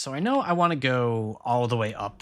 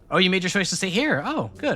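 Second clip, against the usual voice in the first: high, sweet mock approval; fake-proud sing-song; sarcastic praise; 5 semitones higher, 4 dB louder than usual.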